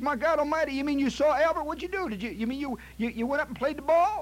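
high-pitched, stammering astonishment; aghast. Acted. Host hearing the guest played beside the alligator, aghast. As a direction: sputter questions in mounting disbelief.

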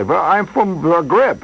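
slow mock Eastern European accent at the border; assumed foreign accent. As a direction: fake a Zagreb native, slowly. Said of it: "you have to assume many accents".

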